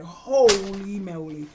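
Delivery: loud, slow drawn-out amazement; stunned exclamation. Retold or imitated. Viewer reacting to Morris's death, drawn-out shock. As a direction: stretch out the first word in shock.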